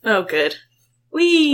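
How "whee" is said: mock whee. Carried in chair.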